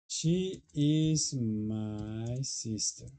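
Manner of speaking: slow, carefully spaced teacher model of "She is my sister"; deliberate classroom demo; slow model sentence. Shown as a demonstration. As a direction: spell it out slowly for the class. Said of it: "yo digo".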